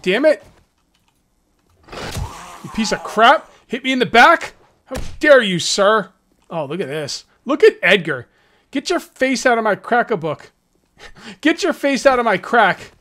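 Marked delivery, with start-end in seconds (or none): 1.9-6.1 mock outrage. Real, not acted. Player hit by zombie, outraged. 8.7-12.9 mock indignant yell. Yelling at zombie Edgar.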